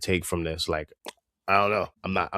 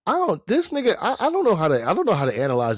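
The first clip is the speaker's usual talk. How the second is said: much higher exasperated outburst; 8 semitones higher, faster than usual. Real, not acted. Host baffled by the show, a much higher exasperated outburst. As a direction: burst out baffled by the character.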